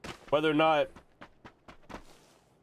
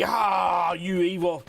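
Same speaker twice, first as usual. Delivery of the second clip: loud, higher mock accusing cry; mock outrage; 4 semitones higher, 4 dB louder, much slower than usual. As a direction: imitate an outraged crowd shouting. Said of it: "they were all just like".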